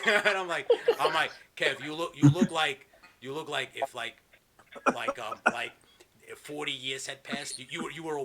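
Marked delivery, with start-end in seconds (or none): none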